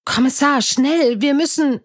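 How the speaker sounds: high-pitched, pressing appeal; urgent calling. A narrator's reading. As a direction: call out to hurry them along. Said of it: "rief Emily".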